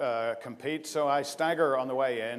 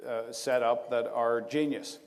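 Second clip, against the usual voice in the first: ordinary delivery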